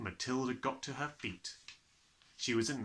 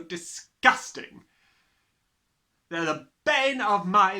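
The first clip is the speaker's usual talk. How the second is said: Trunchbull's booming voice; 7 semitones higher, 7 dB louder than usual. A narrator's reading. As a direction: sneer at the children from the front. Trunchbull ranting at class.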